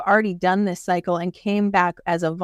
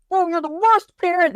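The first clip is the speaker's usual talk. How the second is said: put-on ranting child; 10 semitones higher than usual. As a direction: whine it like a mad kid throwing a tantrum. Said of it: "the child rants and gets mad".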